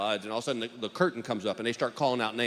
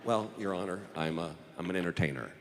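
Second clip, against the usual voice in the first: sheepish mumble; 8 semitones lower, 7 dB quieter than usual. Retold or imitated. Sheepish answers to the judge.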